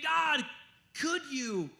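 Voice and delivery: high-pitched mock plea; begging. People coming to God for help, begging. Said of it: "All these people coming in begging".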